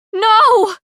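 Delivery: high, loud raw scream. A narrator's reading. Pei screams as her friend falls. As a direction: tear it from the throat. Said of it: "My scream is raw".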